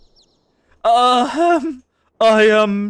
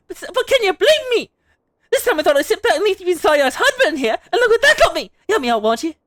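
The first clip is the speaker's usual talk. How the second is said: higher, lively voice of the bold mother; playful wife; 9 semitones higher, faster than usual. Acted. Streamer voicing the Manderville wife, high and teasing. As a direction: sweep through her lines bright and teasing.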